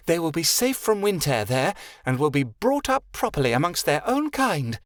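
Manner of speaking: high, proud fairy voice laying out orders; a regal little fairy settling matters; haughty fairy Vixen. A narrator's reading. Vixen keeps speaking.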